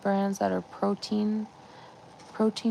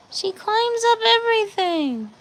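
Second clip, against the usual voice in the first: higher exasperated repeat; 11 semitones higher than usual. Real, not acted.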